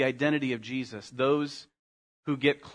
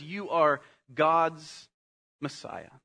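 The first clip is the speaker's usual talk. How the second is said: hushed, slow declaration; slow reverent confession; slower than usual. Acted. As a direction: say it slowly with awe. Peter's confession quoted reverently.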